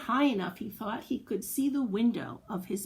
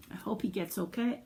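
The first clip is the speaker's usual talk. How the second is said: usual speaking voice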